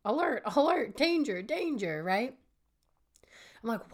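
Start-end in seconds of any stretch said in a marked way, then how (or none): 0.1-2.0 high-pitched warning siren voice; sing-song mock emergency alert; mock alarm. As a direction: sound it like an alarm going off. Voices her brain's alarm warning.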